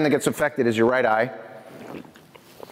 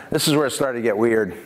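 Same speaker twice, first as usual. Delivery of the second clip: ordinary talk, nothing marked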